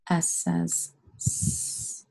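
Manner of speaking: slow "S says S", last S a long hiss; name S, then hold its "sss" sound; letter name, then sound. Shown as a demonstration. Teacher spelling away with pupils, "S says S", ending in a hiss. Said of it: "Spell the word away with me".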